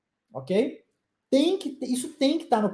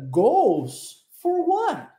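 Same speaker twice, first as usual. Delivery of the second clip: slow question, high rise then falling on what; questioning intonation; 6 semitones higher, much slower than usual. Shown as a demonstration. Teacher models the friend's question with intonation. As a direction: ask Goals for what? slowly with a high questioning tune. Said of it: "uma pergunta com entonação".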